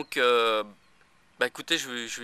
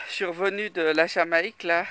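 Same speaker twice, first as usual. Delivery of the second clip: normal talking voice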